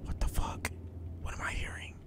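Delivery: hushed, unvoiced whisper. Real, not acted. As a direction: breathe it, spooked. Hearing creepy noises.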